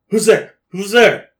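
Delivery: loud high call into the dark. Acted. A scared survivor calls through the door, loud. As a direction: shout it through the door, nervous. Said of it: "She goes".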